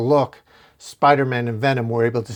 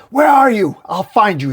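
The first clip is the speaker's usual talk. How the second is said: high, loud, raging scream; furious scream; 9 semitones higher, 8 dB louder than usual. A narrator's reading. Carnage screaming for Torment, high and loud rage. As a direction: bellow it out like a monster hunting its prey. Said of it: "Carnage screams".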